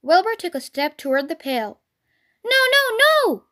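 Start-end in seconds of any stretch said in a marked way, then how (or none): 2.4-3.4 very high, urgent protest; alarmed goose. A narrator's reading. Goose stopping Wilbur nearing the pail, alarmed goose. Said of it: "said the goose".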